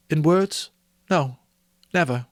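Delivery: put-on Belle, earnest young woman. Belle confronting Scrooge about his love of gain, earnest.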